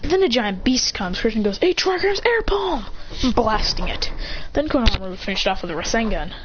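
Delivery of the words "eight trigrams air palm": high-pitched move announcement; called-out attack. Retold or imitated. Ninja blasting a giant beast, high attack call.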